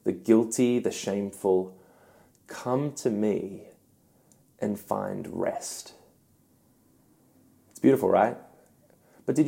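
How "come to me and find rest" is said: very slow, soft and inviting; hushed, unhurried comfort; tender invitation. Acted. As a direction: gently invite the weary in, slowly. Voices Jesus' invitation to the weary.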